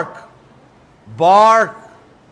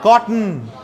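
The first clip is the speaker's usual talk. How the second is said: ordinary delivery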